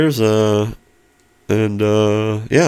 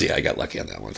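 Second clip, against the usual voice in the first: modest chef; 10 semitones lower, 11 dB quieter, much faster than usual. Retold or imitated. Quotes the chef, offhand.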